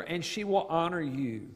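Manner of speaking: normal talking voice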